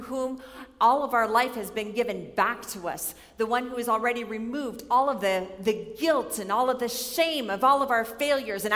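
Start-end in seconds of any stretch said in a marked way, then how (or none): none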